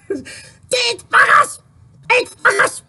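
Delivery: high, slow, shrieking furious impression; pissed-off Claudia. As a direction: shriek it like an enraged friend. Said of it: "Claudia, when she's pissed off".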